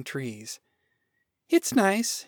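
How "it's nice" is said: higher, hedging female character; polite reservation. Acted. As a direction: praise it with reservations. Woman's lukewarm reply to God.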